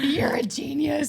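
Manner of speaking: high, drawn-out mock admiration; hammed-up awe; retold gushing praise. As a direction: gush it slowly, way up high.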